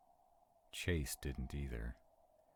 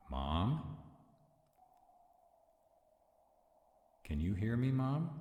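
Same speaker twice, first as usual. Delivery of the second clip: louder call climbing higher; 8 semitones higher, 4 dB louder than usual. Acted. Reaching out to Mom when worried, a loud rising call.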